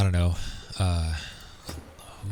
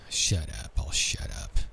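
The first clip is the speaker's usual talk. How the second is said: whispered to self; 4 semitones lower, 5 dB quieter than usual. Real, not acted. Hushing himself mid-game.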